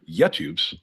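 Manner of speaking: YouTubes with the stress shifted onto TUBES; stress on wrong syllable. Shown as a demonstration. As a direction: lean on the second syllable of YouTubes. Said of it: "There's an emphasis on the wrong syllable".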